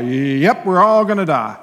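loud, deadpan-style matter-of-fact agreement; comically composed acknowledgement; mock calm. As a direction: play it calm against the panic, still projecting. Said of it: "I just tried to stay calm".

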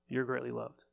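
soft, intimate reassurance; tender assurance. Real, not acted. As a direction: hush down and speak it straight to one person. Personal word to congregant.

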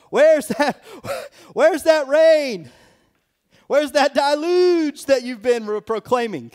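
high, loud jeering mock voice with a laugh; scoffers taunting Noah. Noah's scoffers jeering at him, high loud mock. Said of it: "Noah experienced scoffers going".

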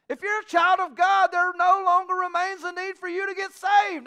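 loud, high, ringing exhortation to the pews; preaching at full force. Acted. Preacher urging the congregation to fill the chairs, shouting. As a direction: rouse the church to go out and work.

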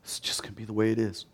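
low, quick, muted resignation; quietly fatalistic aside; resigned mindset. Acted. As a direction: say it softly, as if giving up. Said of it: "the mindset immediately of what set in".

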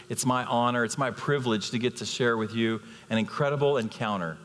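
ordinary delivery

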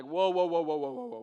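loud burst of whoas, fading fast; acted-out shocked halt; mock alarmed interruption. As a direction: cut in loudly, then let it tail off.

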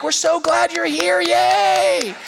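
high, slow, overly sweet welcome; gushing receptionist. Retold or imitated. Dentist receptionist gushing sweetly at a nervous dad. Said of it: "they're like".

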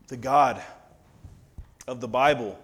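usual speaking voice

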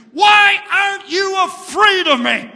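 high, loud frustrated roar; irritated devil. Acted. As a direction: demand it like a fed-up devil. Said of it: "finally irritated and frustrated".

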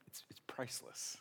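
hushed, breathy delight; whisper. Real, not acted. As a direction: whisper it like a secret treat. Savoring the murderer's face.

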